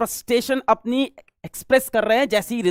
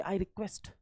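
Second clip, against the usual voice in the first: very soft quoted plea, almost swallowed; imitated humble request; 3 semitones lower, 18 dB quieter, much slower than usual. Teacher pointing out the student's polite language, hushed imitated plea. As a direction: repeat the student's words in a small, hushed voice. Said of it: "उसकी language देखिए".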